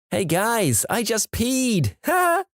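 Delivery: put-on high-pitched, drawn-out party-girl announcement; giggly college girl. A narrator's reading. Pre-gaming student tells her friends on the train, high and giggly. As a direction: announce it in a high, slow voice with a little laugh at the end.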